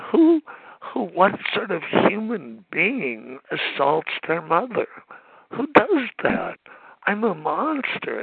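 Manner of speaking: self-pitying outburst. Real, not acted. Speaker at the meeting berating himself for hurting his mother, anguished self-pity. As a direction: cry out against yourself, a monster. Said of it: "that's a bit of self-pity".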